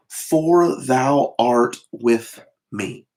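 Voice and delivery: very slow, every word laid down; slow scripture reading. Shown as a demonstration. Preacher dwelling on the psalm's comfort, very slowly. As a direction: read it word by word, unhurried.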